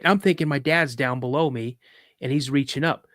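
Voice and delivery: usual speaking voice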